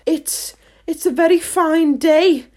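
high, hesitant small talk; timid little character voice. A narrator's reading. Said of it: "said a timid voice".

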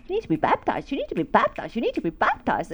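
repetitive singsong nag with swooping pitch; imitated nagging prompting. Inner prompting repeating itself, swooping insistent nag. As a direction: chant it insistently, pitch jumping up and down. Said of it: "The prompting".

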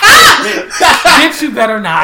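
loud, high laughing yell; laughing shout. Real, not acted. Laughing at own bad accent.

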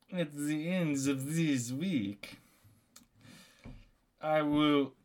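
slow, put-on French accent; drawn-out French-accented threat. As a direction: menace slowly as a stage Frenchman. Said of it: "in the French accent".

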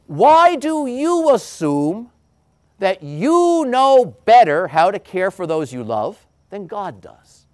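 high, slow pleading question; Jesus challenging the crowd, slow and high; pleading challenge. Acted. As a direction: press the crowd gently but hard.

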